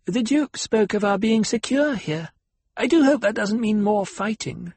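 a fretful guest hoping there's no fighting; anxious lady companion. Acted. Dark-haired companion speaks.

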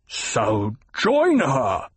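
slow, spat-out command. A narrator's reading. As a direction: spit the words slowly. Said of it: "the Baron spat".

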